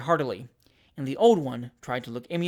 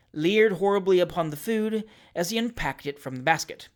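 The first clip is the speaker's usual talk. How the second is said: ordinary delivery, nothing marked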